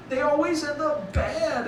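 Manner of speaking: high, slow, drawn-out mock whine; exaggerated, dragged-out complaint; mock complaining. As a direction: imitate a dater moaning about it. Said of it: "we all complain about it".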